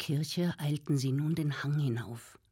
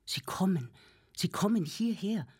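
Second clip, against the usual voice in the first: panting warning, rising higher; breathless alarm; 5 semitones higher than usual. A narrator's reading. Arnošt bursting in to warn the family, breathless and alarmed. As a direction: gasp it out like he ran all the way. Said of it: "schwer atmend".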